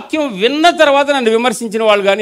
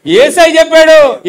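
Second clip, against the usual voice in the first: slow, louder, higher declaration; proclaiming; 8 semitones higher, 6 dB louder, slower than usual. Real, not acted. Preacher declaring to the congregation, slow and loud. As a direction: declare it triumphantly.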